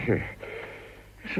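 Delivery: barely voiced yes under his breath; muttered aside. Lord puzzling over a technical report, muttered. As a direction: mumble it, lost in the document. Baffled while reading report.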